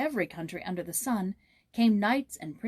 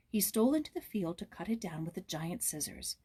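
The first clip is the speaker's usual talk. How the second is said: usual speaking voice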